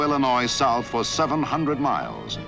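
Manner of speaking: ordinary talk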